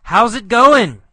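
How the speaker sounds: high, loud shout after her; yelled greeting. Acted. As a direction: yell it to someone ignoring you. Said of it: "And then Carlos yells".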